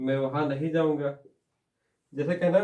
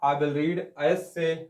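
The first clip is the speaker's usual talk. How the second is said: slow dictation; much slower than usual. Shown as a demonstration. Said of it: "मैं निबंध लिखूंगा".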